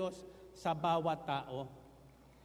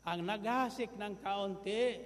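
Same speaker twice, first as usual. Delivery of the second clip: usual speaking voice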